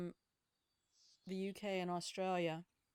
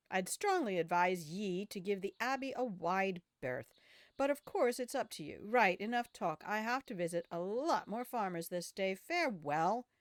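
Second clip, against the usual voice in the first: cautioning game villager; 5 dB louder than usual. Acted. Villager warning a traveller off the Cursed Abbey, animated character voice.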